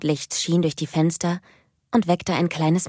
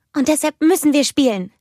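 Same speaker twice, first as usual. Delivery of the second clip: put-on high, loud, eager child voice; bubbling over with excitement; excited little girl; 10 semitones higher, 5 dB louder than usual. A narrator's reading. (hohe, aufgeregte Kinderstimme)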